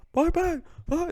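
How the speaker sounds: mock the referee waving it off. Fan mocking the referee who does nothing, singsong.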